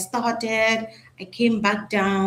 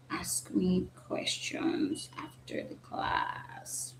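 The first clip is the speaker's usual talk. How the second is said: whisper; 3 semitones lower, 14 dB quieter, much slower than usual. Real, not acted. Aside while setting up call.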